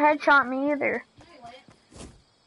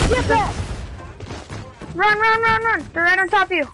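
urgent shouted warning; being chased; 6 semitones higher than usual. Real, not acted. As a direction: yell at a teammate to run. Urgent warning during gunfight.

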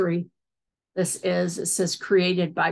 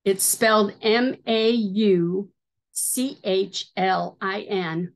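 normal talking voice

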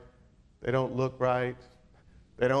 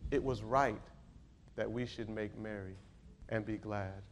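very low, soft preacher's close; subdued, reverent scripture quote; hushed, solemn; 4 semitones lower, 8 dB quieter than usual. Acted. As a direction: let each phrase settle quietly. Hushed closing quote of the father.

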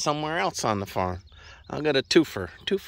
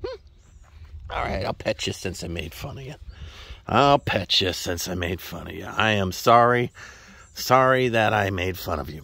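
usual speaking voice

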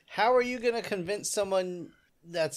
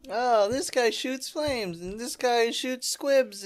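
slow, higher, sarcastic tour-guide voice; mocking slow drawl; 8 semitones higher, much slower than usual. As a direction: drag it out like an unimpressed guide. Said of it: "And it is just like".

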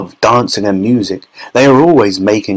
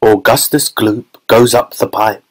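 ordinary delivery, nothing marked

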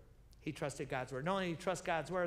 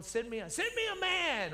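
high mock giant call; a preacher mimicking Goliath's challenge; Goliath's taunt; 12 semitones higher, 4 dB louder than usual.

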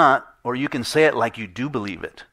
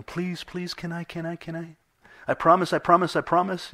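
put-on pleading teenager; 7 semitones higher than usual. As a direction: whine like a teen begging a parent. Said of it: "you know what pleading is".

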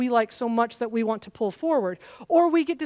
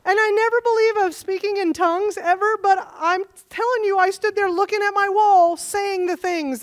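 excited; 7 semitones higher, 6 dB louder than usual. Real, not acted. Excited retelling of night call.

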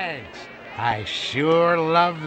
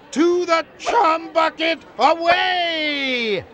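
heroic rallying cry; 7 semitones higher than usual. Acted.

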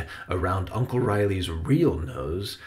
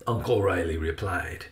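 ordinary delivery

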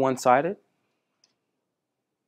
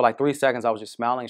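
ordinary talk

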